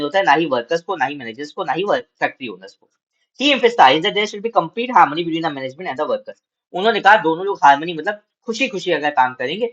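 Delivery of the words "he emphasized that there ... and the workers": slow, measured reading aloud to students; slow classroom read-aloud; slow textbook reading. Acted. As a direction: read each phrase out deliberately for the class.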